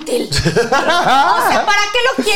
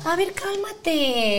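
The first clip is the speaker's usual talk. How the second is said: lento y suave, falsamente tranquilizador; tono de burla; 2 semitones higher, 8 dB quieter, much slower than usual. Retold or imitated. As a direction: imita con burla a quien te calma, arrastrando las sílabas. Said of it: "hasta en un tono de burla". (burla lenta y condescendiente)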